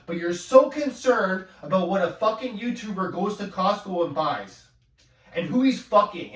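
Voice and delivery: furious rant about snooping viewers; angry rant. Real, not acted. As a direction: vent hard at the commenters.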